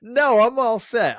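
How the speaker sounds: high, loud, slow mock refusal; a hammed-up loud drawn-out no; firm refusal. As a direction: turn her down with exaggerated firmness. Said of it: "And I go".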